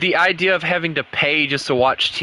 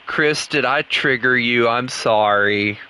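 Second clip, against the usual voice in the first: normal talking voice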